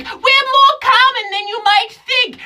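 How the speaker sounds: very high, loud, exasperated genie; sassy genie. Acted. Genie scoffing at the comic who never met one, very high. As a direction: snap at them as an annoyed genie. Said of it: "she's like".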